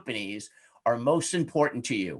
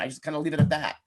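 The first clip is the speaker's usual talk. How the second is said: ordinary talk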